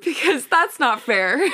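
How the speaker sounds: slow, high, loud whine; pouty wail, drawn out; playful whining. Real, not acted. Playfully protesting her own exclusion.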